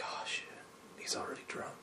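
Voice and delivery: whispered aside. Retold or imitated. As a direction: whisper it as a private thought nobody should hear. Inner thought about drunk friend.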